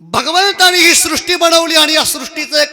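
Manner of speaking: high, loud kirtan oratory; ringing, declamatory sermon; full-force preaching. Real, not acted. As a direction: proclaim the verse's points to a big congregation.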